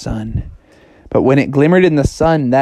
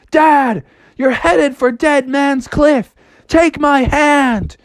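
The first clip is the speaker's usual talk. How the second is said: put-on high slow kid hero; 10 semitones higher, slower than usual. Froggy rescues Dad.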